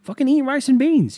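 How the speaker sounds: high, loud, exasperated yell; exaggerated scolding. Swearing host ordering caller, mock exasperated yell. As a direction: blow up the catchphrase into a mock rant.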